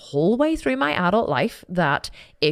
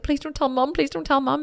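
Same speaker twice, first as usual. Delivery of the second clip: pleading; 9 semitones higher than usual. Retold or imitated. Begging not to tell.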